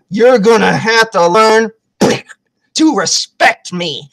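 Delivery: slow words broken up by blows; deliberate scolding. Retold or imitated. Woman has him on the ground, deliberate scolding. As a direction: spell it out slowly, dropping at the end.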